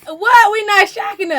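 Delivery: loud protest. Retold or imitated. Partner protests loudly, retold.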